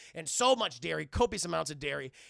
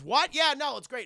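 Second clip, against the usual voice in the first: put-on high, loud, over-eager kid voice; kid's nervous enthusiasm; 8 semitones higher, 10 dB louder than usual. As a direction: cover up a trick with too much cheer. Hogarth covering the laxative trick.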